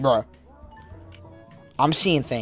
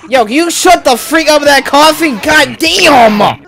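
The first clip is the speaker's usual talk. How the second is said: annoyed shout; 11 semitones higher, 8 dB louder, slower than usual. Real, not acted. Yelling at someone coughing.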